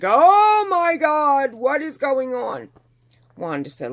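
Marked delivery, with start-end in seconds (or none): 0.0-2.7 high, loud cry of alarm, easing off; shocked exclamation. Retold or imitated. Owner feels the puppy's bones, shocked outcry. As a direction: cry out aghast, drawing it out.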